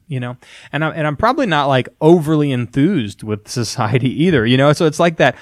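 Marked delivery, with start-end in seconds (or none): none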